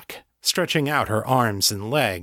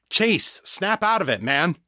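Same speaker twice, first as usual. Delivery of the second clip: urgent call; 8 semitones higher than usual. Acted. John shakes Chase to rouse him.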